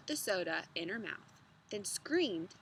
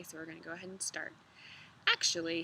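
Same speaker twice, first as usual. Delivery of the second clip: usual speaking voice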